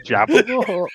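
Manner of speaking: high, loud club compere call; Phoenix Nights bingo caller. Pretend. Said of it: "Peter Kay's, like, Phoenix Nights".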